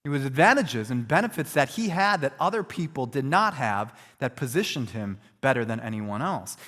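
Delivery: normal talking voice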